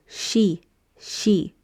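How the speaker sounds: she with long ee; syllable she. Shown as a demonstration. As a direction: blend sh and ee into she. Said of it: "you have sh and E, and you go".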